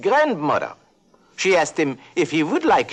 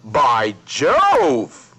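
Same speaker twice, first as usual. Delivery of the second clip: posh exclamation; 4 dB louder than usual. Acted. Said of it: "and said".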